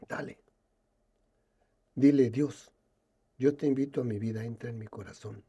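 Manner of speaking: pastor en voz baja, casi al oído; en quedito. Retold or imitated. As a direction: susurra la indicación con calma. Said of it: "así en, en quedito". (el pastor le habla en quedito)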